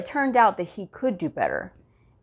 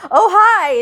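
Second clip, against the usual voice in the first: a bright high louder hi, grinning; excited greeting; 12 semitones higher, 8 dB louder than usual. Retold or imitated. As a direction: greet her all smiles. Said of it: "just smiling, you know, it's really big and excited".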